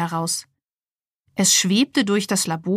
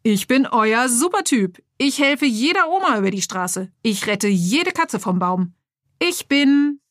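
higher toy hero voice, steadier now; smoother superhero; 4 semitones higher than usual. Acted. Action figure repeats its boast more clearly to the lab. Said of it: "er sprach deutlicher".

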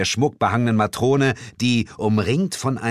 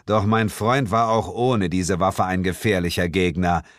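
normal talking voice

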